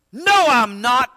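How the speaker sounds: loud, high, drawn-out denial; sharp retort. Acted. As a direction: throw the denial back forcefully. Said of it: "or Peter retorted".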